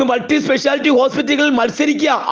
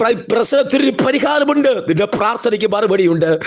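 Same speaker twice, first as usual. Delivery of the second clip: ordinary talk, nothing marked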